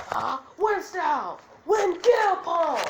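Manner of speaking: high, loud anime attack shout; battle cry. Acted. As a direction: yell the jutsu name, last words drawn out. Acted anime attack call.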